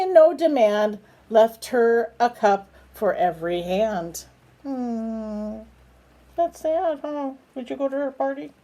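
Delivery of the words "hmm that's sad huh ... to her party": high, soft sympathetic coo. Real, not acted. Parent asks baby about the spider's party, soft high coo. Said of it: "parent talks to baby".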